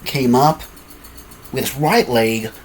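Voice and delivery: ordinary delivery, nothing marked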